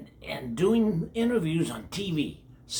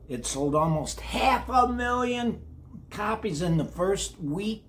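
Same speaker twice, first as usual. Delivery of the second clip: excited; 6 semitones higher than usual. Acted. Impressing sales numbers on kiddos.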